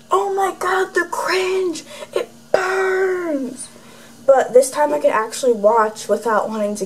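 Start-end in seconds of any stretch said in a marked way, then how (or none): 0.1-3.7 high, soft mock wail of cringe; mock cringing self. Watching her own video, a high mock cringe wail. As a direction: whine it like watching yourself hurts. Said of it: "usually I'm just like".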